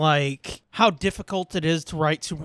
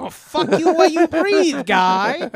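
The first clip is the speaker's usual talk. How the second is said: laughing mock threat; 7 semitones higher, 6 dB louder than usual. Mock tough-guy standoff, laughing.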